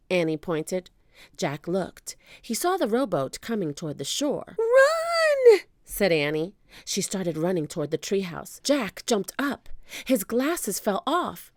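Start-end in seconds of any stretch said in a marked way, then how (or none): 4.6-5.6 high, loud cry of alarm; high-pitched warning yell; urgent shout. A narrator's reading. Annie warns Jack of pirates.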